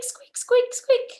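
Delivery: high squeaky mouse voice; playful high mouse squeaks for the kids. Retold or imitated. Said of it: "animal that goes squeak".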